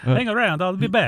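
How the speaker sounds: put-on a light, laughing Italian-accented action movie zinger. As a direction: toss off the one-liner in a hammed-up Italian accent. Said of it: "I'd love to hear that in Franco Nero's accent".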